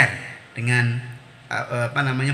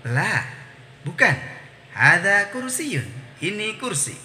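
slow, melodic teacher recitation; question-and-answer drill; 4 semitones higher than usual. Shown as a demonstration. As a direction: draw out the Arabic, translate with a tidy tune.

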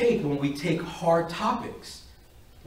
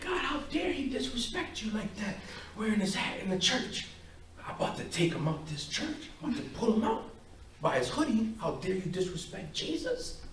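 hushed, indignant fuming to God; soft, outraged private rant; upset; 4 semitones higher, 10 dB quieter than usual. Retold or imitated. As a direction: fume under your breath, much softer.

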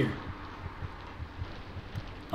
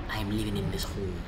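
lower, softer menacing threat; 6 semitones lower, 10 dB quieter, faster than usual. Pretend. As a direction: warn the intruder in a low voice. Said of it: "self-correction".

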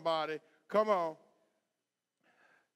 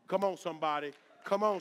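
ordinary talk

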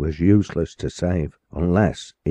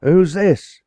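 much higher, loud sudden call; startled cry; 12 semitones higher, 7 dB louder than usual. Acted. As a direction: call out at someone rushing near. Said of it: "he cried".

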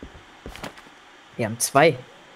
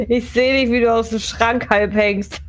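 laughing; 6 semitones higher than usual. Real, not acted. Teasing friend hiding in wardrobe.

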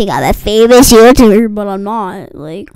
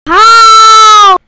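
high disbelieving shout; raging gamer; 11 semitones higher, 7 dB louder than usual. Real, not acted. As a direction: cry out in disbelief. Loses again.

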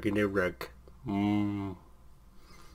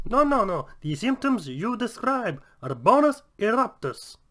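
much higher, chirpy doctor voice; Doctor Nick voice; 10 semitones higher than usual. Acted. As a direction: sound like a very happy Kermit. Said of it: "make a very good Doctor Nick".

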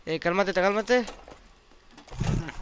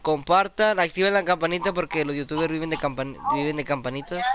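1 youtuber outro; 2 semitones higher than usual. Acted.

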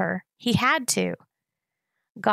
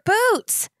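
higher, urgent yell; 14 semitones higher, 4 dB louder than usual. A narrator's reading. Gregor calls to his sister left behind, yelling high. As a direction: cry it out at the top of the range. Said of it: "yelled Gregor".

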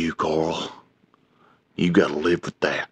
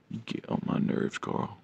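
unvoiced whisper, much softer; 3 semitones higher, 10 dB quieter than usual. Real, not acted. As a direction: hiss it under your breath. Teasing the cat, then repeating louder.

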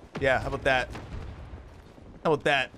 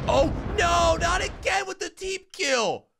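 exasperated yell; 13 semitones higher than usual. Real, not acted. Gamer yelling at a teamkilling teammate, high exasperated shout. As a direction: shout it in disbelief. Teammate kills own side again.

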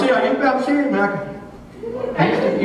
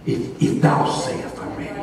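slow, hushed King James solemnity; mock churchy; 6 semitones lower, 4 dB quieter than usual. Man answering the Lord, mock churchy. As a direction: put on a slow, reverent church voice. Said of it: "I don't wanna be churchy".

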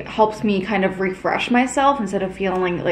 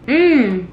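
pleased tasting hum; 4 semitones higher, 5 dB louder than usual. Real, not acted. Tasting the new matcha.

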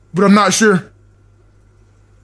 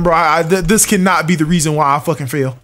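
ordinary talk

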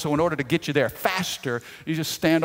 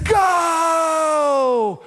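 high, loud shouted go; shouting at a fellow fan; 13 semitones higher, 7 dB louder than usual. Retold or imitated. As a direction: yell it straight at him. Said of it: "he looks at me, he said".

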